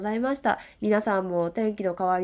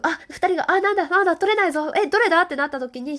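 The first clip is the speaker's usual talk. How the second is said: flustered; 9 semitones higher, 4 dB louder than usual. Retold or imitated. Reenacting a panicked game moment.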